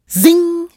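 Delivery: loud, high "zing" of a flying stone; zing it out like a shot; high zinging whizz. A narrator's reading. Stone whizzing into the flowers, sharp high zing.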